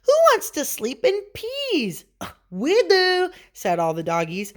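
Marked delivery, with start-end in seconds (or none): 0.0-2.0 very high, incredulous kitten; exclaiming. A narrator's reading. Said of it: "exclaimed Scipito". 2.5-3.3 high, loud, drawn-out answer; doggies chiming in. A narrator's reading. All the doggies answer together.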